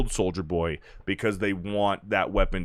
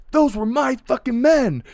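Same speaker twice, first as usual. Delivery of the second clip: high furious yell; mock outrage at the team killing her men; angry yell; 5 dB louder than usual. [name] yells at team.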